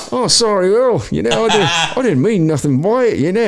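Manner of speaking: high-pitched mock English accent drifting into Australian; botched English accent, Australian-sounding. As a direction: imitate an apologetic Englishman, badly, sounding Australian. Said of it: "that sounded more Australian".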